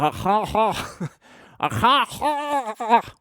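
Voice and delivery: high, slow mocking "ha ha ha"; mock pained laugh. Imitating the injured mate's garbled laugh, high. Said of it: "He's like".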